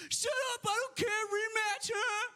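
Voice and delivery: very high-pitched mock scream of an angry kid; imitated screaming. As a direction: scream it like a kid raging into a headset. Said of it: "he's screaming, you know, in this headset".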